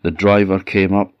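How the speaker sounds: ordinary delivery, nothing marked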